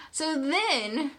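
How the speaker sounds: bubbling with excitement; excited retelling. Real, not acted.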